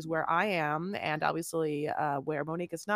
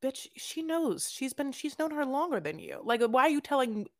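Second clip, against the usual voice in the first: mock telling-off; 7 semitones higher than usual. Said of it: "I wanted to be like".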